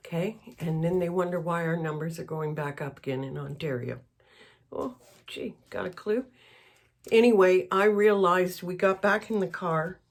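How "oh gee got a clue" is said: breathy whispered dig, soft and mocking; sarcastic whisper. Real, not acted. Vlogger mocks people who wonder why numbers rise, in a sarcastic whisper. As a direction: hiss it out like an eye-roll.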